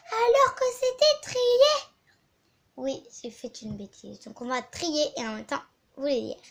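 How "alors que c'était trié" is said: mock outrage. Complaining the sorted books got mixed.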